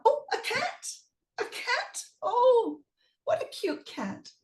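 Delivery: high cooing delight. Acted. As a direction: coo over the kitten. Jack spots the cat.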